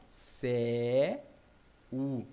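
CÉU broken into a long "cé", pause, then "u"; wrongly split syllables. Acted. Student guessing at separation, céu split as cé-u. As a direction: split céu into two drawn-out pieces. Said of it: "Eu acho que é assim".

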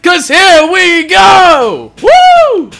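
slow build to a high whoop; loud, drawn-out kickoff cheer; hyped shout. Real, not acted. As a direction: yell it out and whoop. Hypes the rematch start.

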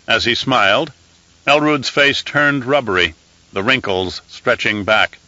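plain reading voice

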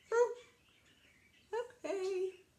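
high, small surrender; meek troll. Acted. Troll backing away from the big goat, meek. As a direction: give in timidly.

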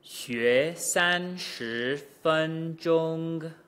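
very slow, every syllable held apart; exaggerated slow drill. Shown as a demonstration. Teacher drilling back to front at natural pitch, very slow. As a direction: stretch each tone out deliberately. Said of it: "exaggeration and slow speech".

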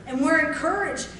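ordinary delivery

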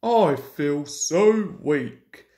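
slow, drawn-out feeble complaint; Gordon feeling weak. A narrator's reading. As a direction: drag it out like a spent engine. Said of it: "asked Gordon".

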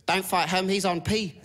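high-pitched imitation of a woman calling out; acted high warning cry; mock urgent call. Lady yelling from car window, acted.

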